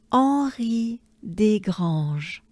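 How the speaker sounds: "Henri Desgranges" slowly, silent H, French r; French name pronunciation. Shown as a demonstration. Teacher modelling the person's name for learners, slow French pronunciation. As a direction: draw out "Henri Desgranges" as on-REE day-GRAHNZH. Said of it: "the name of this person".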